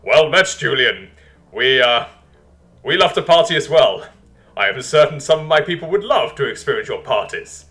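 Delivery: loud, hearty diplomatic welcome; Basilisk, amused leader. Acted. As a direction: greet the tiny king heartily, with a brief stumble.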